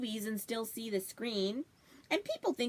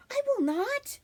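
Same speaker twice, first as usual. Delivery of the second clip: high indignant retort, much higher; indignant protest; 14 semitones higher, 4 dB louder than usual. Acted. Brother protesting he won't drop it, high indignant squeal. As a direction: squeal the denial back at him.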